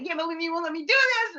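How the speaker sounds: high-pitched put-on child protest; whining kid. Kid protesting to a parent who said no, high whine. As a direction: squeal it like a kid who was told no.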